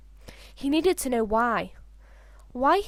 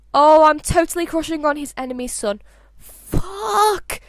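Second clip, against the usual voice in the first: louder and higher, ending in a yelled curse; panicked outburst; 4 semitones higher, 6 dB louder than usual. Acted. Albus realising his dad's enemy's son, panicked outburst. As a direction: blurt it out and explode on the last word.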